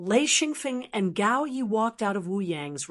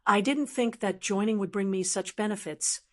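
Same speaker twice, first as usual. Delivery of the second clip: usual speaking voice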